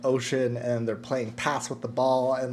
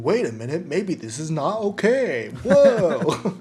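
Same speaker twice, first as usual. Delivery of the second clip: mock realization, rising to a high, louder "whoa"; dawning realization; 4 semitones higher, 5 dB louder than usual. Viewer realizing the scene is not okay, mock surprise. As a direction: act out a mild double take, pitch climbing.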